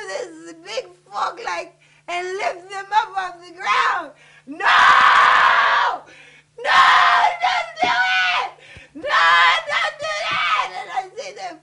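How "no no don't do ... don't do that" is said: very loud, anguished screams of protest; desperate scream. Real, not acted. Daughter watching bodies lifted by a bulldozer, desperate scream. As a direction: scream to make them stop.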